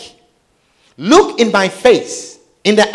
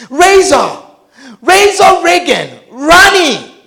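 high, loud calling of his name; distant calling voices; 7 semitones higher, 4 dB louder than usual. Retold or imitated. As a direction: call to him from far away. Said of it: "He heard voices".

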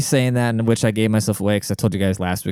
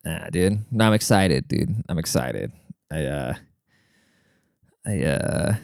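flat, sinking much softer and lower as it goes; deadpan fake enthusiasm fading out; mock unexcited; 7 semitones lower, 5 dB quieter than usual. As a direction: say you're excited while deflating to nothing. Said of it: "I'm just kidding".

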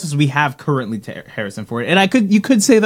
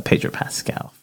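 whisper; 7 dB quieter than usual. Pretend. Dramatic whispered answer.

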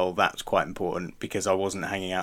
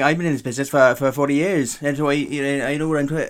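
louder, higher West Country-tinged singer impression; 6 semitones higher, 6 dB louder than usual. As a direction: have another go at the boastful veteran singer, hammed up. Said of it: "I need to get my bearings".

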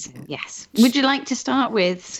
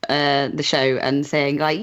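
ordinary delivery

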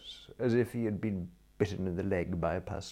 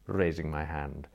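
ordinary delivery

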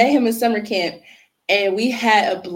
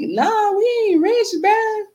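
high voiced younger self; 11 semitones higher than usual. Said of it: "I'm like".

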